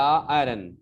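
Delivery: "आयरन" as a short a-run, without full eye; changed pronunciation. Shown as a demonstration. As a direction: shrink the first vowel of "आयरन". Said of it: "वो बदल गया".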